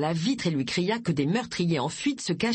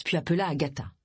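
usual speaking voice

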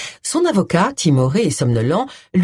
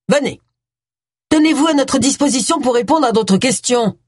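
raised-pitch order, called after them; calling out; 7 semitones higher than usual. A narrator's reading. Policeman to departing suspect and lawyer, calling out. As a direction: call it out, voice pitched up. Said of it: "cria Wilkes".

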